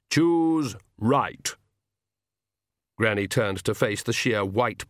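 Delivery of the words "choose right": Death voice. Acted. Death answers Granny.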